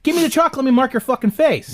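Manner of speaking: high, heated threat; retold angry threat. Threatening the guy marking his tire, high and heated. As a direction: throw it back at him, fired up.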